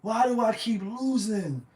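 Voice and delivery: wailing, drawn-out mock sob; imitating a crying Derek Carr wailing over losing. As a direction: ham up a crying player. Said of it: "he was like".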